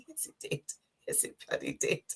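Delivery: whispered under her breath, emphatic; whispered agreement. Retold or imitated. Replying to her friend about the emotional tea, whispered. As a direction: breathe it out like a confession.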